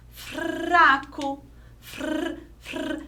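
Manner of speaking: "fraco" with a long rolled R; trembling R. Shown as a demonstration. Teacher showing how the weak R trembles, rolled R. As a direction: roll and stretch the R in "fr". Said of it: "que o som treme".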